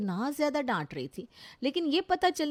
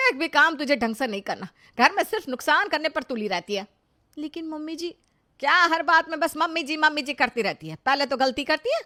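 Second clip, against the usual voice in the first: loud, raised-pitch harangue; angry scolding; 3 semitones higher, 6 dB louder than usual. Acted. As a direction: tear into her for breaking the dishes. Mother-in-law scolds over broken dinner set. (टूटे dinner set पर बहू को ज़ोर से डांटती सास)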